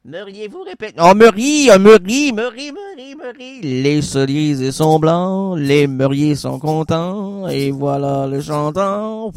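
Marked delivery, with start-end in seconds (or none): 1.0-3.6 loud spirit calling, fading away; seance medium. Pretend. 3.6-9.3 slow, low trance chant; seance medium. Pretend. Spirit's answer in trance.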